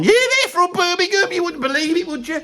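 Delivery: high-pitched incredulous outburst; can you believe it, pitched high; mock disbelief. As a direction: voice leaps right up in amazement. Mock amazement at band's origin.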